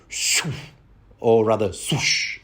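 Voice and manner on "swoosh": unvoiced breathy swoosh of an arrow. Shown as a demonstration. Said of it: "or whatever sound an arrow makes".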